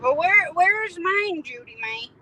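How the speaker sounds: high, loud mock demand; playful whine. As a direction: whine for your share, hammed-up.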